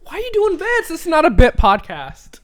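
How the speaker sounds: high exasperated question. Real, not acted. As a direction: toss it up in disbelief. Exasperated outburst.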